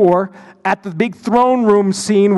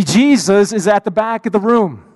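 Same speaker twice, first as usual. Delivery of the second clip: ordinary delivery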